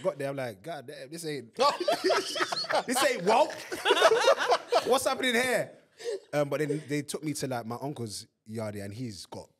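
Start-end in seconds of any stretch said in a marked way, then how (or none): 0.6-5.7 high, loud laughing shock; mock shock, cracking up; laughing disbelief. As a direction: laugh through the confusion. Retold shock on arrival.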